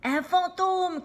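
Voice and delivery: high, loud cry of fright; frightened shout. Acted. Scooby shouts in fright at the ghost. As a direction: shout it out as the scared dog. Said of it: "crie Scooby".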